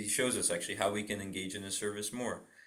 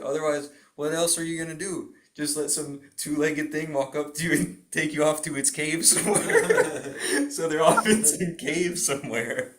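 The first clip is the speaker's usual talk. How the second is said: laughing, louder and higher; 6 semitones higher, 8 dB louder than usual. Retold or imitated. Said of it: "He said".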